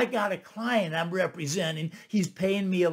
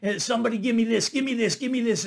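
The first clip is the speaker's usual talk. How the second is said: auctioneer fast talk; 3 semitones higher, faster than usual. Retold or imitated. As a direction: rattle it off like an auctioneer working the room. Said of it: "how they do that fast talking".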